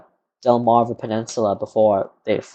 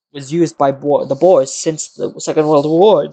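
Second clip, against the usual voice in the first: ordinary talk, nothing marked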